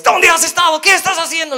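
high, loud, exasperated questions; scolding questions. Retold or imitated. What Jesus does not say to the found sheep, high loud scolding. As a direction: fire the questions high like a nagging parent. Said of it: "lo primero que dice no es".

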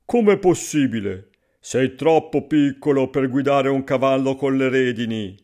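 loud, lightly voiced incredulous father; amused farmer. A narrator's reading. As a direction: scoff mildly and loudly at the idea. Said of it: "si mise a ridere e disse". (padre incredulo, voce forte)

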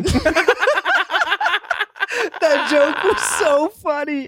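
high-pitched, talking through laughter; real helpless laughter. Real, not acted. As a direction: crack up while getting the words out. Cracking up over remembered joke.

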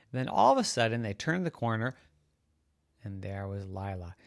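plain reading voice

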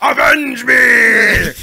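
slow high wailing cry; overacted death scene. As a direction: ham up a dying villain's wail. Mock dying villain cry.

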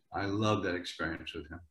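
normal talking voice

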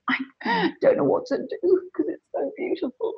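imitating her childhood self sobbing at music; retold tearful child. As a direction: gasp through the words like a weeping little girl. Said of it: "I was sobbing".

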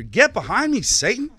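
loud, high, forceful rebuke; stern rebuke. Acted. Preacher reading Jesus rebuking Peter, loud and stern. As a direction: throw the words at him with full force. Scripture rebuke read aloud.

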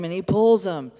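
ordinary talk

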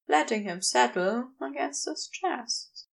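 ordinary delivery

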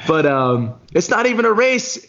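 high-pitched indignant outburst; mad rant. Retold or imitated. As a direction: squeak out the outrage, pitch way up. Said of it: "I was mad 'cause I was like".

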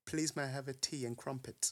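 slow, soft attempt at a posh accent. Shown as a demonstration. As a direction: carefully copy a posh English accent. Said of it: "I can't do a posh accent".

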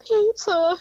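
high, wailing pizza; mock crying. Wife retelling her crying over pizza, high and wailing. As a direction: squeak it out like a tearful kid.

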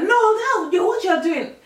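high, loud imitation of mum's alarm; exaggerated indignant cry; mock outraged mum. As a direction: scold like an exasperated mother. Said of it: "She's like".

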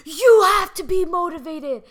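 loud, high motivational-speaker yell; mock scream. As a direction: scream it like a fired-up drill coach. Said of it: "screaming at me".

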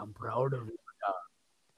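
soft, fading deathbed voice; dying parent. Retold or imitated. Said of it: "put your hand in the deathbed".

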